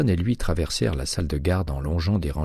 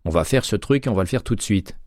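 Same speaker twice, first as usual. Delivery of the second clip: higher and louder, taking charge; firm call to order; 5 semitones higher, 3 dB louder than usual. A narrator's reading. Hitchens ordering the men to stop arguing, raised firm voice. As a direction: shut the argument down firmly. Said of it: "s'écria Hitchens".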